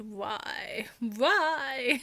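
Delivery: loud, drawn-out mock vampire voice; Count Dracula. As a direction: ham it up as a spooky vampire count. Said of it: "that was my Count Dracula voice".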